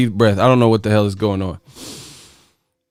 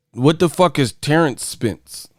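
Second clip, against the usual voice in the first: ordinary talk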